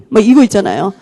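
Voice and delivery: usual speaking voice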